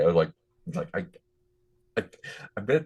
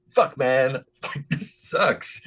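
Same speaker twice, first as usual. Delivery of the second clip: mock grumble; 7 semitones higher, slower than usual. Quoting Jaxu's reaction.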